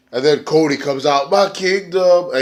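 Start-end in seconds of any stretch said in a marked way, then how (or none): 1.3-2.3 slow, higher, dramatic proclamation; grand entrance. Retold or imitated. Cody arriving after the lights go out, slow grand proclamation. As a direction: announce it like royalty.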